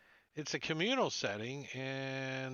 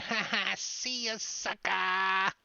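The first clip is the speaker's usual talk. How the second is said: taunting monster; 8 semitones higher, 7 dB louder, slower than usual. Acted. Monster taunts buddy running past.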